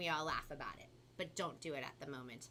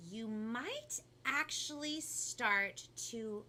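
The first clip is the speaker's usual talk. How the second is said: very slow reveal dropping to a whisper; picture book ending; 4 semitones higher, much slower than usual. A narrator's reading. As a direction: draw it out, then finish hushed. Reads picture book to children.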